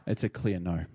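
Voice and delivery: teasing fake refusal; joking. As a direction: pretend to weigh it up, then laugh.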